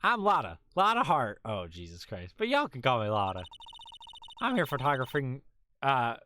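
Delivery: lightly drawled Southern country gal; folksy Southern accent, mild; a hint of a Southern belle accent. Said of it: "the, like, southern voice".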